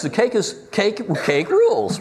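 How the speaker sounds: a youthful high-pitched burst over cake; voice going up. Real, not acted. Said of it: "my voice went up like that".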